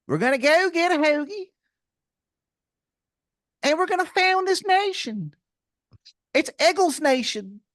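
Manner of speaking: put-on grate it out in an over-the-top Philly accent; terrible Philly accent. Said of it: "that's how you would practice it".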